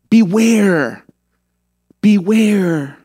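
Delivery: stretched, emphatic caution; drawn-out warning. Acted. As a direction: sound a solemn warning, holding the word. Acting out Jesus's warning.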